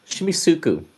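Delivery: normal talking voice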